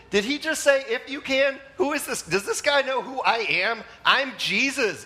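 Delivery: high-pitched, hammed-up offense; mock indignant.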